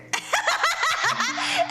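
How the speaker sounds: high, rising giggle. Real, not acted. Host to viewers, giggling mid-story.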